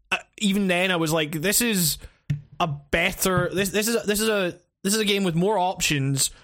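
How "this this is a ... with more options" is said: ordinary talk, nothing marked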